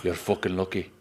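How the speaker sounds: put-on Irish accent. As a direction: joins in with an Irish scold. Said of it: "Irish accent".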